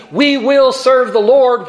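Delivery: slow, loud, high public vow; proud proclaiming. Retold or imitated. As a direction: proclaim it like a crowd swearing loyalty.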